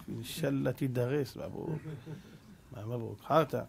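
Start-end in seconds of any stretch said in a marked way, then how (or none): 0.1-1.8 low, slow, hushed curse; very soft fake congratulations hiding spite; secret ill wish. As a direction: wish him harm under your breath. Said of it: "בתוך הלב".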